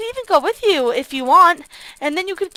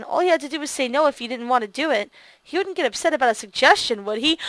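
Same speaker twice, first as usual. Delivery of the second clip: story narration; 2 semitones lower, 4 dB quieter than usual. A narrator's reading. Player quietly reads the narration aloud. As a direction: read the story lines quietly to viewers.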